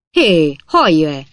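loud, slow, drawn-out call; loud, irate hail; angry shout. A narrator's reading. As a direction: yell furiously after someone. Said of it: "kiáltott rá Pickwick úr dühösen".

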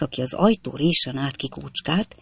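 ordinary delivery, nothing marked

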